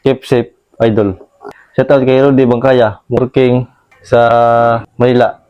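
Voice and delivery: radio shout-out. Acted. Reads shout-outs like a DJ.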